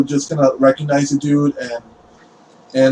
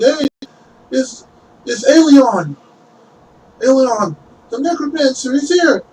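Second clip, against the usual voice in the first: high, excited cries of recognition; townsfolk calling out; 11 semitones higher than usual. Acted. Townspeople shout to the priests that the necromancer is here, high and excited. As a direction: alert everyone he's here.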